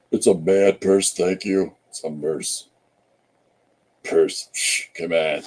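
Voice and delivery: low, emphatic bad impression; hammed-up low voice, mock offended; Paul Pate. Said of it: "worst impression of Paul Pate".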